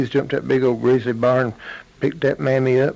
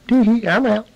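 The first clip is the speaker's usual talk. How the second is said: high, loud giggly squeal; gleeful little girl; 9 semitones higher, 5 dB louder than usual. Acted. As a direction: squeal it in triumph. Little girl escapes the bear's belly.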